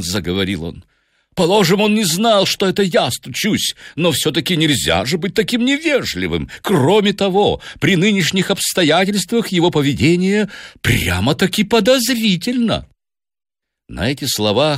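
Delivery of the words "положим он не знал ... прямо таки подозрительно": high-pitched, indignant complaint; offended professor fuming; angry. A narrator's reading. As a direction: protest in red-faced outrage. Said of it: "Сомс был весь красный от гнева".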